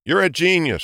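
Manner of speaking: high, sarcastic sneer; snarky. A narrator's reading. Hare mocks the hedgehog with a sneer. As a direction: toss the compliment as a taunt. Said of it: "snarked Jules".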